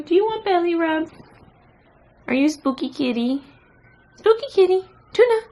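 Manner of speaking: very high cooing voice to the cat. Real, not acted. Baby talk to the cat.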